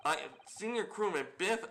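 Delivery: lightly voiced rough sailor with a foreign lilt; a hint of a gruff sailor. Sailor corrects the visitors about his name, lightly gruff. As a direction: play the proud sailor, only lightly.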